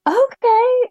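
high, loud burst of joy; overwhelmed yes to her son; delighted okay. Real, not acted. Mom overjoyed at son's wish.

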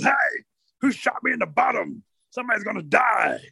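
high-pitched, indignant bear; high cry of injured outrage; outraged bear. Acted. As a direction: yelp it high, threatening whoever did it. Bear shot, jumps up threatening.

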